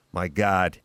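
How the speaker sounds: lightly voiced doctor breaking dire news; mock-solemn pronouncement, a touch dramatic; grave doctor. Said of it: "He's like".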